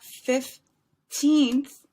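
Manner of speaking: put-on "fifteenth" slow and loud, long high "teenth"; stretched TEENTH. Shown as a demonstration. Teacher showing the longer sound, "fifteenth" with a loud, drawn-out teenth. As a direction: punch and stretch the second syllable of "fifteenth". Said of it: "gonna be a little bit longer".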